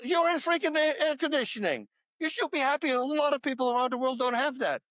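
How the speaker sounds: high-pitched rant at complainers; exasperated scolding. Acted. Scolding people who complain of discomfort.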